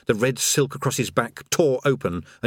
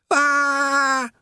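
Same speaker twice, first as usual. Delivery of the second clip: a sudden high yelp of fright; panicked yelp; 4 dB louder than usual. Acted. Rincewind plummeting, letting out a high yelp. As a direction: yelp in terror as you drop. Rincewind repeats while falling.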